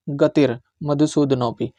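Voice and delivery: गतिर said with a clear final r before मधुसूदनोपि. Shown as a demonstration. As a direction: repeat गतिर मधुसूदनोपि, stressing गतिर. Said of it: "इसको गतिर बोलेंगे".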